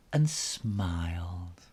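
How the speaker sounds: ordinary delivery, nothing marked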